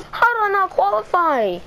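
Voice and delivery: high, whiny, near tears; whiny wail. Real, not acted. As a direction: wail it in despair. Cry heard, high pitch.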